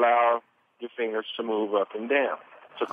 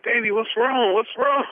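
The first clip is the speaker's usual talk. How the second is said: much higher, anxious grandmother asking what's wrong; worried grandmother; 12 semitones higher, 3 dB louder than usual. Retold or imitated. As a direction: voice the frightened grandmother pleading on the phone. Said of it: "She's like".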